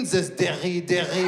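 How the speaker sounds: put-on puzzled word in a French accent; DERRY repeated carefully with a French accent; French woman's accent. French woman asks about the boy's word, French accent. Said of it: "the French woman comes up".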